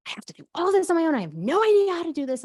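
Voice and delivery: fast, high and loud venting; panicked rant about doing it alone; frustrated outburst. Retold or imitated. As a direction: spill it out overwhelmed and fed up. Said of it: "I was just so effing pissed".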